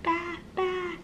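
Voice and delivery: put-on high, bleating baby-lamb sound. Mother showing her child what the lamb says, high bleating. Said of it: "What's the lamb say?".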